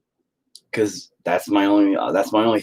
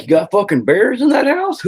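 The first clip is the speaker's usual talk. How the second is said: higher, louder disbelieving question; shocked visitor; 12 semitones higher, 4 dB louder than usual. Retold or imitated. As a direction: gasp at the mess like a stunned guest. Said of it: "People will be like".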